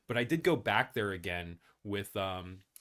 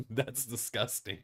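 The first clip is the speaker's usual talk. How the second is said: disgusted laugh; 4 dB quieter, slower than usual. Real, not acted. Reaction to giant rats.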